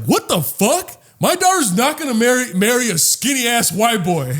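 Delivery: hammed-up indignant mother; mock angry mom. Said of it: "and she's like".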